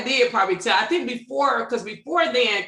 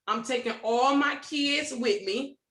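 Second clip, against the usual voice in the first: slow deliberate plan; 3 dB quieter, slower than usual. Retold or imitated. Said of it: "So I said".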